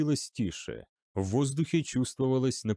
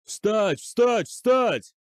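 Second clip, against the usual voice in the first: high, loud repeated shouts; yelled command; 9 semitones higher, 6 dB louder, much slower than usual. A narrator's reading. General yelling at his falling troops. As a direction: scream them onto their feet. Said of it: "вопил Дев".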